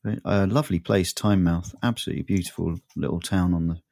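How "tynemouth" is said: ordinary talk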